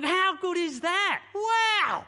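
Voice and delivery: high, loud, amazed cry of joy; joyful shout. Acted. Angels shouting for joy at creation, high and loud. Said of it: "They're shouting for joy".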